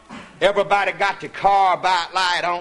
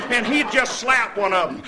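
ordinary talk, nothing marked

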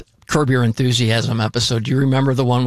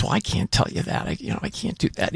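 hushed, unvoiced whisper, much softer; whispering server; 7 semitones higher, 7 dB quieter, faster than usual. Server whispering his refusal to Larry, hushed. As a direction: whisper it like a discreet waiter who can't tell.

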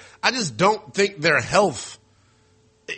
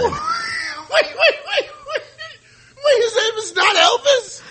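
laughing disbelief. Real, not acted. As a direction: cry out in laughing shock. Host learns the middle name is wrong.

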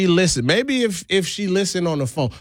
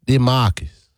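Southern accent; 3 semitones lower, slower than usual. Shown as a demonstration. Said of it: "Should I do, like, a Southern accent?".

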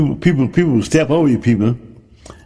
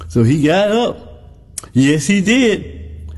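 slow, high, emphatic preaching; building sermon emphasis; 5 semitones higher, slower than usual. Real, not acted. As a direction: lean into it slowly, lifting the pitch.

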